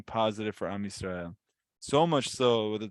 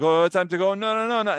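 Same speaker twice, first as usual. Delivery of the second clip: a higher, louder mock protest of no, no, no; reluctant whine; 7 semitones higher, 5 dB louder than usual. The people balking at the order to travel, a higher mock whine. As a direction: play the people's dismayed refusal.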